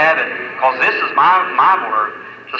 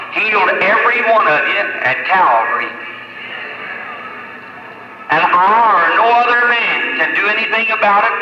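raised-pitch revival preaching; fervent preaching; 6 semitones higher than usual. Real, not acted. Preaches at full force.

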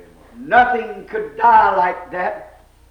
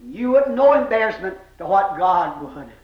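normal talking voice

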